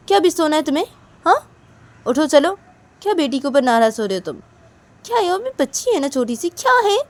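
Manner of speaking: loud, high insistent coaxing; nagging friend. Acted. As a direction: keep pestering her to get up. Manisha nags Nisha to get up.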